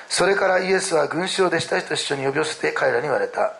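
measured Bible reading voice; scripture reading. Acted. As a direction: read the passage aloud, deliberately. Reads scripture aloud. (聖書朗読の読み方)

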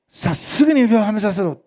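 loud, urgent fatherly order; a father firmly interrupting his son; father's loud command. Retold or imitated. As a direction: call it out like an overjoyed father. Father overrules son's plea.

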